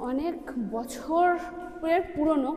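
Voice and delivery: echoing inside a stone cave; words ringing off the rock walls. Real, not acted. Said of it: "কথা তো ইকো হচ্ছে বুঝতেই পারছেন".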